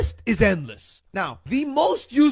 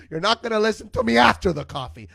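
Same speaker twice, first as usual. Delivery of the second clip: ordinary talk, nothing marked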